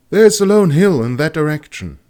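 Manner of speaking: high and loud, eager; excited exclaim. A narrator's reading. Student spotting a hill, exclaiming excitedly. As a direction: spot it and call it out. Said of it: "she exclaimed".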